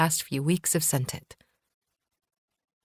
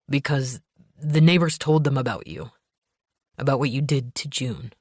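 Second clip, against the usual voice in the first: lightly lowered, grim husband; a hint of a tense man on the phone; 3 semitones lower than usual. Gabe voiced lower.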